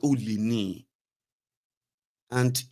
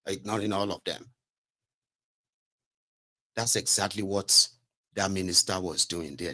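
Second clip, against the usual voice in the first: normal talking voice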